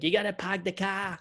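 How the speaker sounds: lightly put-on Boston accent, dropped r in car; a hint of Boston, park the car as pahk the cah. Podcast host teasing the Boston accent, mild Boston accent. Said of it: "It's like".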